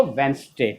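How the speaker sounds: WEDNESDAY as a low, soft wens-day; correct pronunciation. Shown as a demonstration. Teacher checks the class, वेंसडे as "wens-day". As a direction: say वेंसडे in two falling syllables. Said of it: "तो क्या बोलेंगे इसको?".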